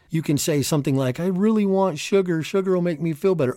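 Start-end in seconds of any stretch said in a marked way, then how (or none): 1.2-3.6 put-on high, pleading childish whine; whiny craving voice. Craving inner voice begging for sugar, high childish whine. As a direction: beg for sugar like a petulant child.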